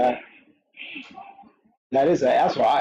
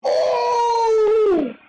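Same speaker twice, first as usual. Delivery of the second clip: shocked shout; 4 dB louder than usual. Real, not acted. Knockout shock watching fight.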